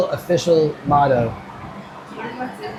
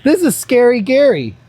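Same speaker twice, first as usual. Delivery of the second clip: playful announcement; 9 semitones higher, 6 dB louder than usual. Real, not acted. Showing off a spooky prop.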